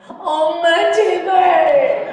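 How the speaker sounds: high, loud, drawn-out plea; pleading cry. Retold or imitated. Daughter begging her mother, high drawn-out cry. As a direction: wail it like a desperate kid.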